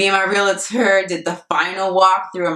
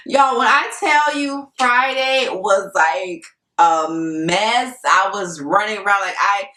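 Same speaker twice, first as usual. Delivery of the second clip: ordinary talk, nothing marked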